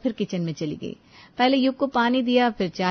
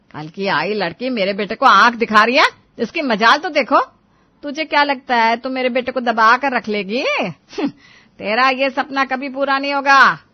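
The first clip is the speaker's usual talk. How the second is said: loud, threatening mother-in-law; angry; 3 semitones higher, 8 dB louder than usual. Acted. Mother-in-law warns daughter-in-law off her son, loudly angry. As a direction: lay down the law, loud and furious. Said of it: "उसे गुस्सा आ गया और गुस्से में बोली".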